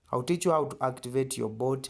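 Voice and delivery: ordinary talk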